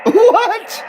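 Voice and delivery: stunned high-pitched what; surprised. Real, not acted. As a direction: yelp it in disbelief. Shocked reaction to joke.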